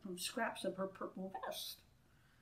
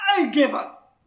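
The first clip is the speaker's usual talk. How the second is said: king's loud exasperated shout, pitched up; 4 semitones higher, 15 dB louder than usual. A narrator's reading. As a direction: throw up your hands and yell it. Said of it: "the king shouted".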